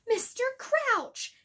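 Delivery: high, loud, slow shout. Acted. Harry shouting at Snape, desperate and loud. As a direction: yell the name at him. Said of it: "Harry shouted".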